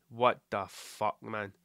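low, very slow disbelief; dismayed inner thought. Acted. Thinking it on saying the cat's name, slow dismay. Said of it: "I just think".

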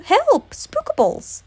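high, loud alarmed call; forlorn cry for help. A narrator's reading. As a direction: cry out high, then drop a little. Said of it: "Pooh's forlorn cry".

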